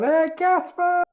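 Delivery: high, drawn-out holler; yelling for Casper. Acted. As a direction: call out his name, stretched and high. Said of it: "I just start yelling".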